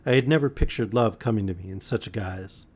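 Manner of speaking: ordinary delivery, nothing marked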